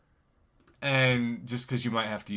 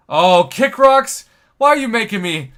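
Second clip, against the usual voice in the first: excited; 8 semitones higher, 12 dB louder than usual. Real, not acted. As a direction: marvel loudly at his hero. Reacts to his own hero.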